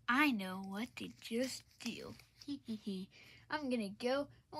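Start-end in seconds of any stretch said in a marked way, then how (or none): 0.1-2.1 slow, conspiratorial whisper; scheming whisper. Acted. Kicked-out dad whispering his sneaky plan. 2.5-3.0 sneaky giggle. Acted. Dad plotting revenge.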